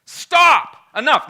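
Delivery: high, loud shouted commands; leader's rebuke. Acted. Preacher as their leader silencing the people, shouted rebuke. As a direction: shut the crowd down, word by word. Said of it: "Their leader just turned to them and said".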